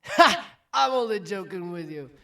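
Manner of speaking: loud, high "Ha!" easing down; big hearty joking outburst; jovial dwarf. Acted. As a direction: burst out as if teasing. Dwarf drops act, laughs it off.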